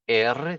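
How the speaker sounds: "R" as "erre" with a tongue-tip roll; Spanish trilled R. Shown as a demonstration. Teacher correcting to Spanish, R as trilled erre. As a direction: roll the middle of the letter name R. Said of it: "Que é o trill".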